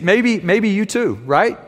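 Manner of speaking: normal talking voice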